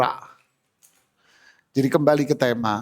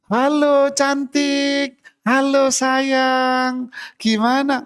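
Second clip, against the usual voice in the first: high, slow, sugary greeting to a friend; syrupy slow sweet-talk, pitched well up; sweet phone talk; 9 semitones higher, much slower than usual. Retold or imitated. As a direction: coo it like a wife charming her friend on the phone. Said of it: "halus ngomongnya".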